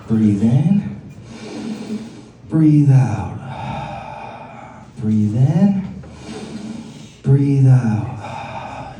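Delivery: low, soothing instructor voice with long pauses; guided yoga breathing. Acted. Comic leading the crowd in a mock yoga breathing session. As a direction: lead the room through slow breaths like a yoga teacher.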